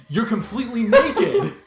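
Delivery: ordinary delivery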